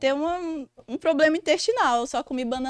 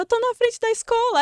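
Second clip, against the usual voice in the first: voz aguda de choro encenado; choro imitado; 9 semitones higher than usual. Retold or imitated. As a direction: imita a si mesma choramingando ao telefone. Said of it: "Eu já chorando". (chorosa, aguda, com exagero cômico)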